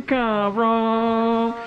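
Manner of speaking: ordinary talk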